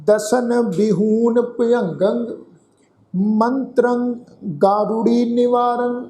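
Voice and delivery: slow, drawn-out chanting of the verse; slow scripture recitation. Shown as a demonstration. Teacher recites the verse for the congregation, slow chant. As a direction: intone each word slowly and reverently. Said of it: "ਇਹਨੂੰ ਸੁਣੋ ਧਿਆਨ ਨਾਲ".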